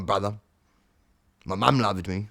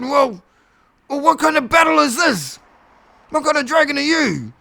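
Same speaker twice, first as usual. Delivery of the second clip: high, loud demanding shout; fed-up yell; exasperated shout; 12 semitones higher, 5 dB louder than usual. Acted. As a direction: yell the challenge upward. Cat shouts question at dragon.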